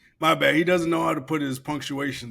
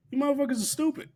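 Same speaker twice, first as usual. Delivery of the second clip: exasperated; 12 semitones higher, faster than usual. Real, not acted. Scolding the pranksters.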